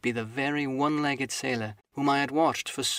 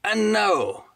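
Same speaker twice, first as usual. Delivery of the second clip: aloud again; 8 semitones higher, 6 dB louder than usual. Acted. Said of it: "he ran on again aloud".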